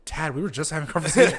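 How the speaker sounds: fast, laughing protest; laughing while pleading with a drunk friend; laughing plea. Retold or imitated.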